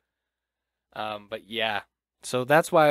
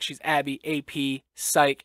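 usual speaking voice

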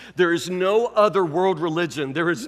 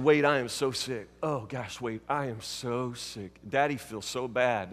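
mock self-pitying moan, soft and low; milk it for pity like a sulking patient; pitiful moaning; 9 semitones lower, 11 dB quieter than usual.

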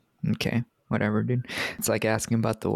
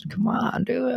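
much higher, pleading come on; high mock whine; 12 semitones higher, slower than usual. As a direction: whine it like an exasperated friend. Mocking Finn's excuse.

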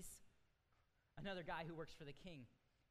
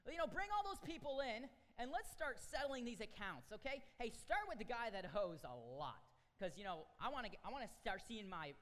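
louder, higher, bossy king summoning his debtors; loud pushy king wanting his money; demanding king; 4 semitones higher, 7 dB louder than usual. Acted. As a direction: play the impatient king barking orders to bring the debtors in. Preacher acts out demanding king.

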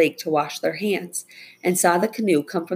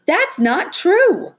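very high, loud, stretched-out objection; indignant girl's outburst, pitched right up; sputtering protest; 6 dB louder, much slower than usual. A narrator's reading. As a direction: protest loudly in a high, drawn-out voice. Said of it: "Becca sputtered".

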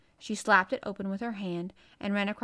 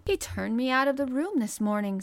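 heated, raised and louder protest; excited; 4 semitones higher, 6 dB louder than usual. A narrator's reading. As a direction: protest with a touch of agitation. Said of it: "stamping her foot with excitement".

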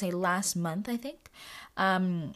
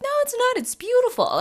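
much higher and louder, adamant; insistent protest; 7 dB louder than usual. Retold or imitated. Answering people who call the sky ugly, high and adamant. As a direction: defend the sky passionately.

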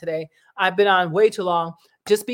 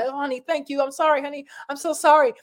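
higher, apologetic pretend wife; playing the wife; 7 semitones higher than usual. Vlogger as a pretend wife apologizing, pitched higher. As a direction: go along with the act, sweetly sorry. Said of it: "I'm pretending like he's my".

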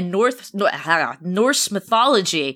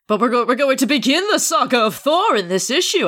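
quick, swooping comic-book proclamation; hyped announcer read; 5 semitones higher, faster than usual. Acted. Reading all the cover text aloud, hyped announcer. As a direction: grandly announce the start of a saga.

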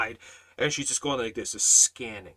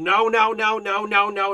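high, louder retold screaming; mock screaming; 7 semitones higher, 6 dB louder than usual. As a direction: imitate her frantic string of refusals.

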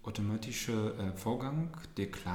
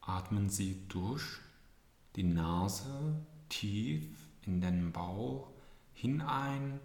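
soothing spa whisper. Acted. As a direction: calmly talk them through breathing. Leads a breathing exercise. (sanfte Atemübungs-Anleitung)

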